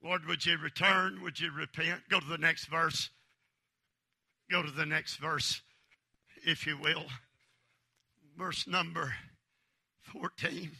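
soft, low prayer voice; hushed prayer. Acted. Preacher quietly praying the psalm, hushed and low.

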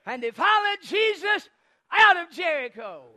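high, loud pulpit proclamation; ringing sermon cry, easing down at the end; triumphant preaching. Real, not acted. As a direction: shout the story's climax. Preaching climax of the story.

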